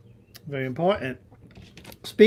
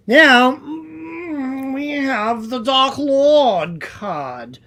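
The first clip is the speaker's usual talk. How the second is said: dramatic reveal; 6 semitones higher, 7 dB louder, much slower than usual. Acted. Dramatic reveal of Dark Lord card.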